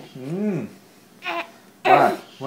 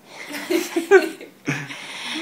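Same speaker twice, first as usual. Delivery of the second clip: laugh; 5 dB louder than usual. Real, not acted. Laughing during froggy game.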